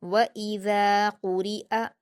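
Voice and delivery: व इज़ा कुरिअ recited slowly with crisp hamzas; clear short hamza. Shown as a demonstration. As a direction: draw out व इज़ा कुरिअ, keeping the last अ short and distinct. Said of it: "यहाँ हमज़ा पर हरकतें इसलिए इसको जल्दी पढ़ेंगे".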